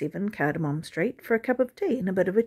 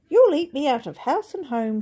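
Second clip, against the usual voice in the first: fussy widow; 6 semitones higher, 4 dB louder than usual. A narrator's reading. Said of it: "she said".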